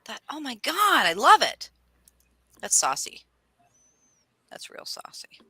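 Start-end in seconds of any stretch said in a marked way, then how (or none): 4.5-5.4 whisper. Real, not acted. Aside after a retold joke.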